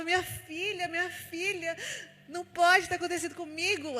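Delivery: high, anguished cry for her daughter, somewhat retold; crying out in despair. Mother hearing her baby has no heartbeat, crying out in anguish. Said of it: "Eu gritava, eu chorava".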